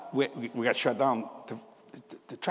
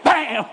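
loud, high bam; bam sound effect; 11 semitones higher, 7 dB louder than usual. Retold or imitated. Retelling the arm wrestle, loud high bam. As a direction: slam it out like a fist on a table. Dad slams knuckles on table.

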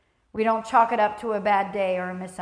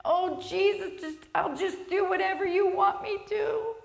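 mock crying; 9 semitones higher than usual. Worshipper moved at Sunday service, acted tearful high voice. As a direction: imitate a weepy, high-pitched prayer. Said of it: "and I'm just crying".

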